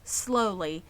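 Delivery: ordinary delivery, nothing marked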